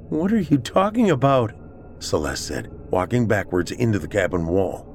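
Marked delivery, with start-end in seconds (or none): 0.1-1.5 high-pitched anxious question; alarmed woman. A narrator's reading. Celeste backing away from changed Pat, alarmed.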